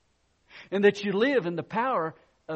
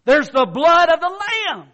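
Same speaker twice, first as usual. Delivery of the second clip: much louder and higher triumphant cry; preaching at full force; 10 semitones higher, 14 dB louder than usual. Real, not acted. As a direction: proclaim it in triumph. Preacher's climax line.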